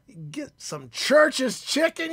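ordinary talk, nothing marked

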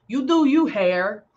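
playful cheer. Real, not acted. Cheering on her own hair.

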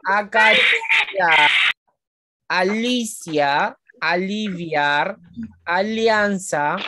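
each word pulled apart into slow syllables. Shown as a demonstration. Teacher sounding out words for pupils, very slowly. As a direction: sound out every syllable for the class. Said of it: "Ven, por ejemplo".

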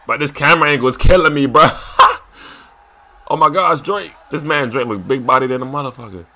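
usual speaking voice

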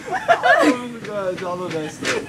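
drawn-out disbelief through giggles; laughing. Real, not acted. Friend reacting to "Denied", laughing. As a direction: start slow and let laughter carry it.

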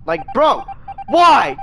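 high, loud why; real disbelieving shout; outraged yell. Real, not acted. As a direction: yell it at the screen. Outraged at being killed.

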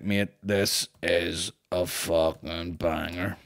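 put-on low, slow half-drunk drawl; half-pissed. Half-drunk man raving to his mate about a tune, slow tipsy drawl. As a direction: drag it out like you're half pissed. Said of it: "I was half pissed".

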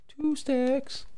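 very high silly squeak; squeaky high voice. Pretend. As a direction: repeat it in a funny high squeak.

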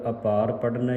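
usual speaking voice

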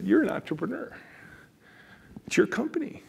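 lightly voiced high coaxing tempter; a devil on the shoulder egging him on; tempting shoulder voice. The other shoulder voice tempts the boss, a high coax. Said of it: "two voices sitting on each of my shoulders".

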